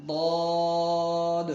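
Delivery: ضاد loud, long, with rounded vowel; stretched ضاد. Shown as a demonstration. Teacher models correct reading, ضاد long and full. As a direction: chant ضاد slowly, bouncing final D. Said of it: "بلکہ ضاد پڑھنا ہے".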